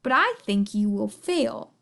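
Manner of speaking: low, scornful sneer; smug bird dropping the pitch. A narrator's reading. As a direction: sneer it down low, sure of victory. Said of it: "sneered the bird".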